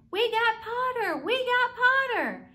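loud, high cheering yell; twins yelling. A narrator's reading. Weasley twins cheering Harry joining Gryffindor, yelling. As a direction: whoop it out in triumph. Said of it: "twins yelled".

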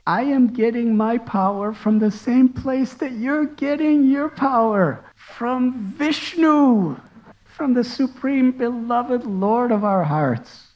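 tender child's reply, gently voiced; gentle and sweet. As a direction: gently answer the angry father. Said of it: "very gently and sweetly replying".

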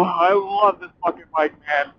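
normal talking voice

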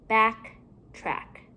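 BACKTRACK with a gap between "back" and "track"; two separate parts. Shown as a demonstration. Teacher building compound words for pupils, halves kept apart.

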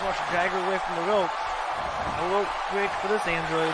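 excited live play-by-play calls; play-by-play commentary. Acted. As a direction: call the match like a ringside commentator.

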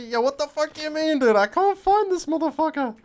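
high, loud exasperated squeal; high loud disbelief; high baffled outburst. Real, not acted. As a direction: squeak in disbelief hunting the enemy. Baffled searching for enemy.

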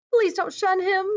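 high, pleading acted cry. A narrator's reading. Naomi begs the elders for Fancy, crying out. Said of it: "Naomi cried".

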